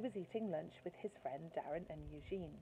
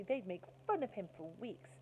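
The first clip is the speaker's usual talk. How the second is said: ordinary delivery, nothing marked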